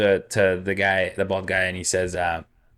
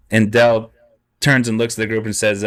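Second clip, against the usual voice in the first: ordinary talk, nothing marked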